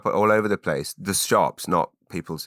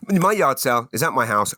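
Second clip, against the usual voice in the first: normal talking voice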